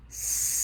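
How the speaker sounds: long hissing "s" with no voice; drawn-out s like a snake. Shown as a demonstration. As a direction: hold "s" as a steady breathy hiss. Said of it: "I'm gonna try to".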